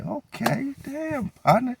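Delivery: squeaky, high, amazed voice; impressed stranger. Retold or imitated. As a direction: play the stranger, startled and impressed. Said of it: "They say".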